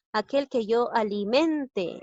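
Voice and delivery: slow reading, stretched MEN in "alimente"; deliberate ali-MEN-te to show the strong syllable; stress on penultimate syllable. Shown as a demonstration. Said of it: "aquí lleva la mayor fuerza de voz en la sílaba men".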